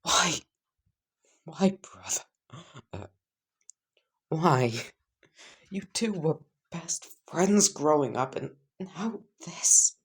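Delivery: high, half-whispered grief; grieving sister. Acted. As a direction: Katsumi mourning her lost brother, high and half-whispered. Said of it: "And she would just say".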